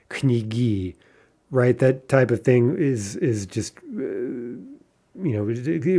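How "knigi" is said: "knigi" said kni-GI, weight on the last i; end stress on knigi. Shown as a demonstration. Teacher repeating the unlikely plural, "knigi" stressed kni-GI. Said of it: "would be very unlikely".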